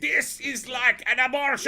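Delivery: loud, high, slow mock Latvian wail; bad Latvian accent. Streamer hamming up outrage at the devs, bad Latvian accent. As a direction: shout it in a hammed-up Latvian accent. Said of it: "That's the worst Latvian accent ever".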